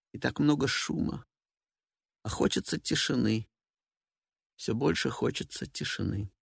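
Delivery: soft, weary inner musing; longing for silence, softly; quiet reflective monologue. Acted.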